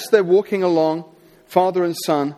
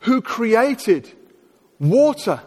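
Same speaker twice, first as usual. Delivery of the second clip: slow, rising preacher build, each God stressed; a hint of a revival preacher; building sermon cadence; 5 semitones higher, slower than usual. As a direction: preach it, stacking each phrase higher.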